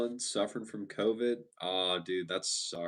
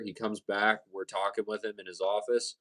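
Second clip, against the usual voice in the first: ordinary talk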